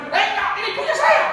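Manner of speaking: high, loud possessive protest; indignant refusal. Acted. Acted refusal to owner reclaiming item. (protes lantang, tidak mau mengembalikan)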